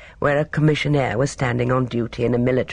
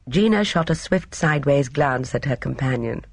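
usual speaking voice

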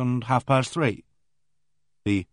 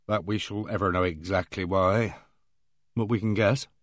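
noir detective; 2 semitones lower, 3 dB quieter than usual. A narrator's reading. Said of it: "said Nash slowly".

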